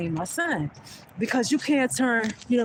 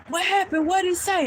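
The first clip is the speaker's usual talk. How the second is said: worried son; 9 semitones higher, 4 dB louder than usual. Retold or imitated. Son asks anxiously after the confrontation.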